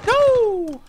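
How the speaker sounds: sudden high-pitched no; shocked high yelp. Real, not acted. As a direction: cry out in betrayed shock. Shocked at being killed.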